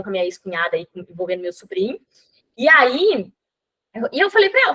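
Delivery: usual speaking voice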